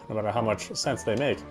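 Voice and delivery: ordinary delivery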